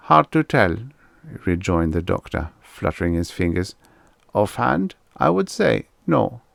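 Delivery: judge it like a German shrink; German accent. Acted. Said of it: "a German accent".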